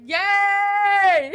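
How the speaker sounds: loud, high, long-held cheer; elated cheer. Real, not acted. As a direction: cheer it out and hold the vowel. Celebrating a fellow Vocaloid fan.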